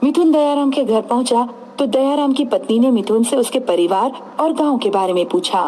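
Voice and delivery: plain reading voice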